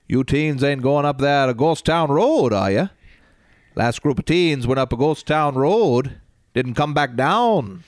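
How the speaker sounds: put-on creepy Maine local warning teens; Fred Gwynne Maine accent. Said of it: "I always do a, uh, Fred Gwynn".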